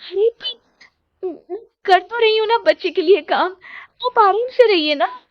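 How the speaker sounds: high, coaxing plea; pleading wife. Acted. Wife coaxing her angry husband, high and pleading. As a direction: soothe the husband into calm.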